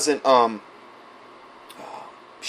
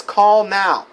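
higher and louder, pressing plea; urgent begging; 7 semitones higher, 6 dB louder than usual. Retold or imitated. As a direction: beg them to call, right now. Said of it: "She's begging this".

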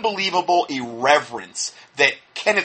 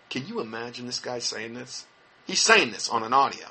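usual speaking voice

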